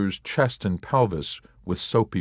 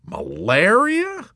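high, slow, louder incredulous repeat; outraged disbelief; 5 dB louder, slower than usual. A narrator's reading. Warrant officer repeating malaria, incredulous. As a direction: stretch the word malaria in outrage. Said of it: "But malaria?".

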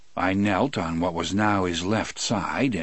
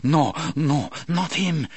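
horrified; 7 semitones higher than usual. Acted. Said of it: "horrified voice".

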